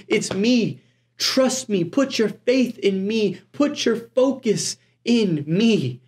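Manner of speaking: slowing, insistent preacher's appeal; urgent plea. Acted. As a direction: plead as Christ calling from the storm. Preacher voicing Christ calling from storm.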